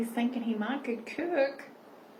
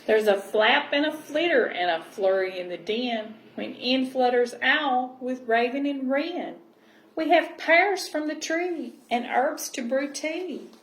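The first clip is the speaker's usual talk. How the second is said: gentle storybook reading voice; cosy read-aloud storytelling; reading to children; 7 dB louder than usual. Acted. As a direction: read it like a bedtime picture book. Reading picture book aloud.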